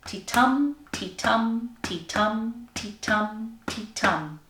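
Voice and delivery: "ti-tum" in even beats, weight on TUM; heartbeat rhythm. Shown as a demonstration. Teacher showing pupils the pentameter beat, rhythmic chant. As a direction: chant each "ti-tum" as weak-strong, falling on the last. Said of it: "it goes like this".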